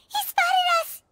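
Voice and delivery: alarmed cry. Acted. Caught following him.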